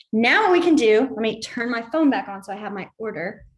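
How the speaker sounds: ordinary delivery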